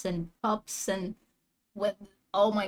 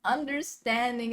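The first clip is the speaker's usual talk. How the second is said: high, loud frustrated question easing off; exasperated complaint with a laugh in it; real frustration; 7 semitones higher, 5 dB louder than usual. Real, not acted. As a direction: burst out in frustration, then settle. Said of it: "It still frustrates me so much".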